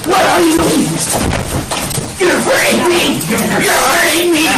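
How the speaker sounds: high, frightened crying out in pain; pleading as they hurt her; panicked cries. Real, not acted. Defendant pinned down by officers, crying out high in pain and panic.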